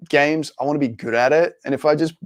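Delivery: ordinary talk, nothing marked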